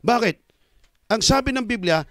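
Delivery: ordinary talk, nothing marked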